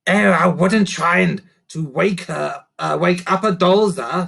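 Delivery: a little creature offering advice; helpful creaker. Acted. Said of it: "said Sniff helpfully".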